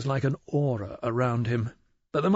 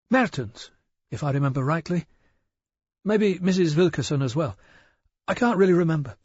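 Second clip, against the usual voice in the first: higher, hesitant shopkeeper voice; a jittery village shopkeeper eager to please; an anxious storekeeper answering a detective, nervous and higher; 6 semitones higher, 4 dB louder than usual. Acted.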